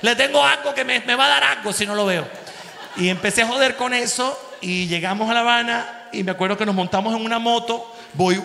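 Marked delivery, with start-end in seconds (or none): 0.0-2.3 high, excited Cuban soap voice, louder; hammed-up Cuban accent longing for Titón. Joking on the plane in a Cuban accent. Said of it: "En el avión empecé".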